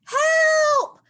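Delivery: high, loud shout from inside a log; high panicked call for help; shouted cry for help. Acted. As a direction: yell for help, stuck and desperate. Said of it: "Tiny hears".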